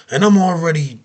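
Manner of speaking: usual speaking voice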